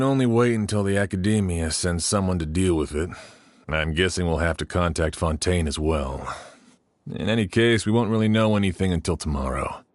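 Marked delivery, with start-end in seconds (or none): none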